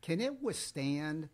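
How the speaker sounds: usual speaking voice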